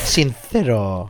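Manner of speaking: "Sincero" drawn out slowly and low with a th for C; exaggerated Castilian C. Shown as a demonstration. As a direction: stretch "Sincero" with a lisped C. Imitates the Madrid lisped C.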